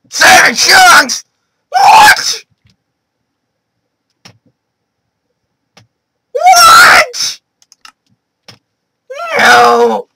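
loud high panicked cries; panicked shouting. Real, not acted. Gamer panicking at seven chunks. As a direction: shriek what in disbelief.

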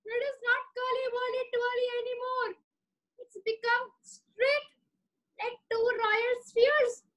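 high, whiny voice of a panicking king; distraught Raja. Acted. Raja lamenting his straight mustache to himself, high and whiny. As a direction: wail like a spoiled king in despair.

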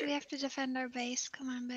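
low whisper. Real, not acted. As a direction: whisper the plan. Whispered plan.

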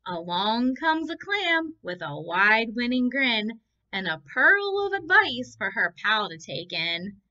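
put-on lilting read-aloud for children; story reading. A narrator's reading. Teacher reading the book aloud to the class, lilting. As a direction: read it like a picture book to little ones.

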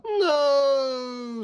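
long, very high wailed cry; high-pitched cry of cartoon horror; storybook scream. A narrator's reading. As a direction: scream it out, stretched and falling slightly. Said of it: "screamed the veggies".

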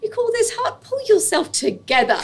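mock a no-nonsense mother telling you to toughen up; stern mum. Mum scolding her crying daughter at 1am, imitated stern. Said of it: "And my mum was, like, literally like".

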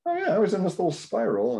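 mock startled awakening; waking up. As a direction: snap out of a daze.

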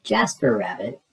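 plain reading voice

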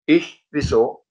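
high, loud startled "Ich?"; the little dragon caught off guard; surprised dragon. Acted. Der kleine Drache fragt verblüfft. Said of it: "fragte der Drache".

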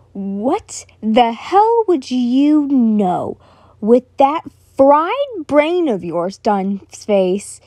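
slow, drawn-out angry snarl. Acted. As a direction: snarl it, dragging the words. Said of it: "Bakugo snarled".

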